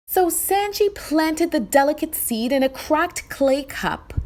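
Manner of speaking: ordinary delivery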